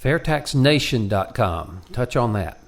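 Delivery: ordinary delivery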